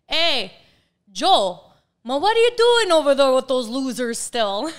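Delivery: put-on loud, high, laughing Italian-accented dad calling out; father's Italian accent. Late father teasing an agent at a rival firm, loud laughing Italian accent. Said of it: "with the little Italian accent that would be like".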